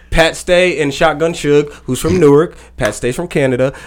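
ordinary talk, nothing marked

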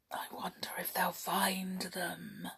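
soft unvoiced whisper. Real, not acted. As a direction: confide it in a whisper. Reader's aside, hushed.

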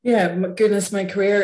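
normal talking voice

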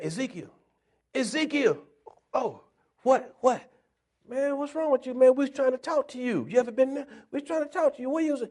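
lightly voiced crowd nagging Ezekiel; people calling.

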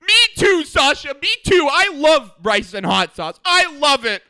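high, loud, slow gushing; excited fan. Retold or imitated. To wrestler Sasha on TV, ecstatic agreement. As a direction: scream it like a thrilled fan. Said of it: "And I was like".

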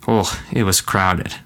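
ordinary talk, nothing marked

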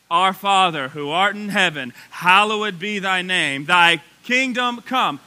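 loud, slow recitation of the Lord's Prayer; recited prayer. Acted. Pastor leading the congregation in the Lord's Prayer, loud and slow. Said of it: "Let's start reciting it right together".

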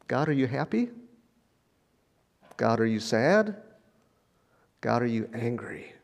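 soft, grave questions to God; quiet, sober, tentative asking; hushed questioning. Acted. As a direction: ask God gently, voice dropping away. Gently questioning God about war.

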